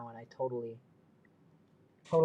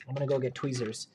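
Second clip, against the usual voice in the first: normal talking voice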